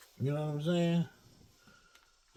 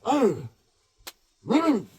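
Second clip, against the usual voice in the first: a louder, higher, strained grunt, then a tight mm; tiger grunt; 6 semitones higher, 9 dB louder than usual. Acted. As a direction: act out the inner tiger bursting to get out. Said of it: "You feel that tiger ready to come out".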